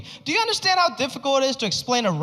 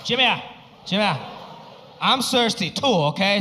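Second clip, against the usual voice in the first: put-on light Chinese dad accent. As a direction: ask like a puzzled immigrant dad. Dad joining in.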